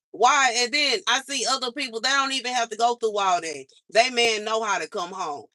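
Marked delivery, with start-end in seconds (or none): 0.1-0.5 exasperated why. Real, not acted. Venting frustration at God.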